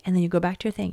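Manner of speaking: ordinary talk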